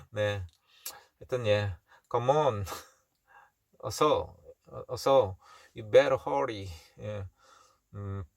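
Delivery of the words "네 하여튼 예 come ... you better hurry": ordinary talk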